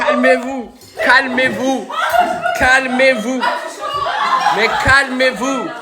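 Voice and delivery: high, laughing, slowing calmez-vous; high laughing plea, slowing down. Real, not acted. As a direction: beg them to calm down through laughter. Laughing at excited crowd.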